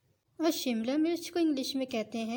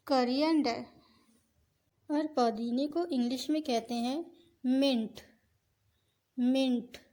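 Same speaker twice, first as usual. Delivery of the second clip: ordinary talk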